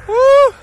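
loud high woo; excited cheer. Real, not acted. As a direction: whoop of joy.

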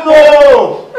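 loud, high cry of protest; outraged denial. Acted. Friend rejecting the robbery accusation to the officer, outraged denial. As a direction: shout it in disbelief.

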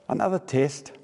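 usual speaking voice

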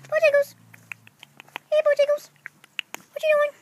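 high-pitched cooing hello; high pet talk. Real, not acted. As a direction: greet a pet in a high little voice. Talking to pet chicken by name.

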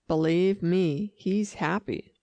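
ordinary delivery

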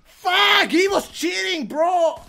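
real loud, high raging yell; furious shouting. Real, not acted. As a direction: rage at the cheater. Raging after death in game.

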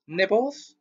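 high, sugary offer from a sly poltergeist; sweet poltergeist. A narrator's reading. Peeves offering fungus peanuts, sickly sweet and high. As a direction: sweeten it up, all fake charm. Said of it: "he said sweetly".

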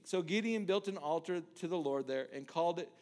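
normal talking voice

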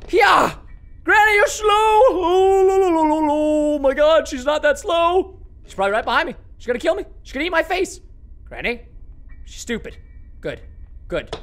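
normal talking voice

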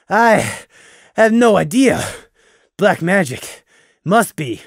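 out of breath, words in short bursts; acted winded reply, broken by pauses; panting. A narrator's reading. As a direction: pant it out between breaths. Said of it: "Gloom panted".

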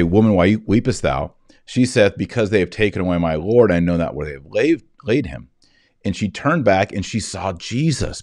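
ordinary delivery

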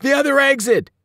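high, loud shout to teammates; urgent command. A narrator's reading. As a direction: shout directions in an emergency. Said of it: "I told my teammates".

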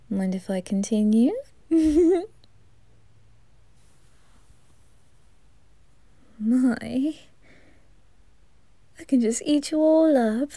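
campy reaper flirting, giggly and teasing; flirty Grell. Acted. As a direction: seduce the listener playfully. Grell flirts with listener throughout.

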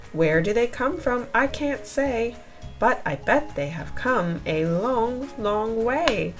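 plain reading voice

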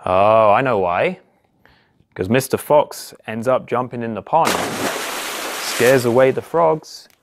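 ordinary delivery, nothing marked

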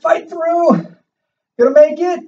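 high, strained push through effort; straining through burning legs. Real, not acted. Straining through wall sit.

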